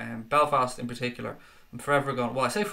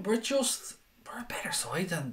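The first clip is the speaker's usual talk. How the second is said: whispered aside; 5 semitones higher, 6 dB quieter than usual. Real, not acted. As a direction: drop to a slow whisper mid-sentence. Confident aside, dropped to whisper.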